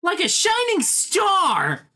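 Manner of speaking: very high, drawn-out mock exclamation. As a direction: send it soaring in a hammed-up imitation. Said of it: "I will raise my voice like that".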